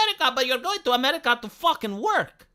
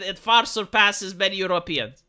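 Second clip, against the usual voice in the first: ordinary talk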